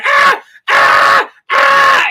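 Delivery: very high mock panic scream; hammed-up Airplane-style shriek; panicked scream. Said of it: "Remember Airplane".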